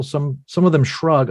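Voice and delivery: ordinary talk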